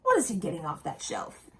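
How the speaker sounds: plain reading voice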